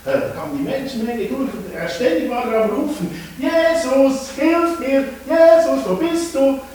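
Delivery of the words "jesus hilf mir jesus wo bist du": high, loud repeated cry; loud calling. Acted. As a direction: keep calling out for help. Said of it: "Mega und laut".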